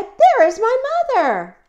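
very high, loud joyful cry; thrilled little bird at full pitch; excited baby bird. Acted. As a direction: shout the happy discovery. Bird thinks it found mother.

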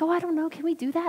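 high, unsure friend's voice; imitating hesitation.

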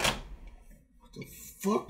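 breathy stunned whisper, drawn out; confused whisper. Retold or imitated. Waking up next to a stranger, whispering in shock. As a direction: hush it like you just woke somewhere wrong.

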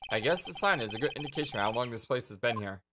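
ordinary delivery

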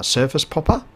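ordinary talk, nothing marked

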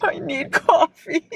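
high, slow tearful whine; crying. Real, not acted. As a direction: squeak it out through tears. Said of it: "I'm still crying".

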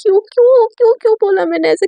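high, loud panicked repeats of why, cursing herself; panicking girl scolding herself, voice jumping up; frantic self-blame. Retold or imitated. Sakshi berating herself after failing Sunaina, panicked. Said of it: "साक्षी खुद को मतलब गालियाँ दे रही होती है".

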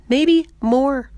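high, loud, excited realisation; emphatic repeat. Acted. [name] repeats it with more emphasis.

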